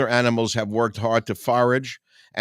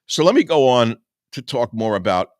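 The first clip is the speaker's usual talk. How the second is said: ordinary delivery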